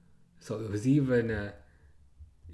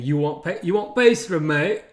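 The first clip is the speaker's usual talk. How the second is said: louder, higher mock English accent; imitated English accent; 4 semitones higher, 6 dB louder than usual. Streamer re-enacting the French pastry seller's imitated English accent. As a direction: mimic a Frenchwoman attempting English slang. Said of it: "That's kinda how she said it".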